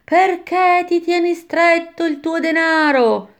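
high, drawn-out taunting cry; exclaiming damned souls. A narrator's reading. One band of damned taunting the other, exclaiming. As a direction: chant it out slowly on a high held pitch. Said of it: "esclamavano gli uni".

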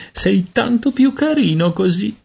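high-pitched mocking baby talk; teasing coo. A narrator's reading. Kate teasing baby-bodied Peter. (vezzeggiativo canzonatorio e acuto)

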